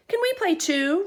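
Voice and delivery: high, loud little rabbit voice; a small rabbit asking to join; eager rabbit. A narrator's reading. Rabbit asks the animals to join the game, high. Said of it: "Rabbit asked".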